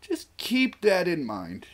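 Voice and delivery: slow, high, drawn-out warning. Pretend. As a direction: stretch the warning out slowly, still high. Playful repeated warning, second take.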